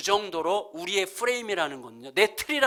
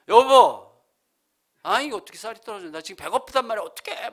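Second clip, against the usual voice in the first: peevish whining; 3 semitones higher, 5 dB louder than usual. Retold or imitated. Hungry husband griping to wife about no rice, peevish. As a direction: re-enact a hungry husband's petulant fuss. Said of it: "신경질을 내가지고".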